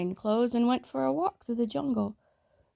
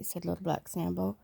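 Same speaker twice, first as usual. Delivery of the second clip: ordinary delivery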